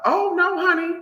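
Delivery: high, loud dismissal; emphatic no. Real, not acted. Emphatic denial.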